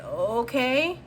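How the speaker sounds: drawn-out okay, rising doubtfully at the end. Retold or imitated. Reader voicing the client answering Spirit, slow and doubtful. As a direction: go along with it, unconvinced. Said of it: "And you're like".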